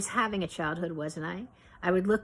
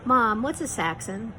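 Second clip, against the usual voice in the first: showing off; 4 semitones higher, 5 dB louder, slower than usual. A narrator's reading. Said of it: "trying to demonstrate the advanced nature".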